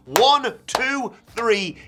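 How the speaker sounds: loud, high count of the pin; excited pin count. Real, not acted. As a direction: count it like a ringside fan. Calling the pinfall count.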